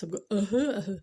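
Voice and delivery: ordinary talk, nothing marked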